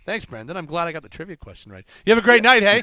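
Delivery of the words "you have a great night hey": loud, high, beaming goodbye; excited. Real, not acted. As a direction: send them off buzzing with joy. Cheerful sign-off after winning trivia.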